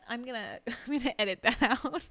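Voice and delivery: loud, laughing vow; laughing embarrassment. Real, not acted. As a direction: laugh through the words, loudly. Laughs at own lost thought.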